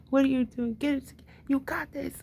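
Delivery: high-pitched hyping someone up; retold pep talk. Cheering someone on mid-conversation, high-pitched pep talk. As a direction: urge them on, pitched well up.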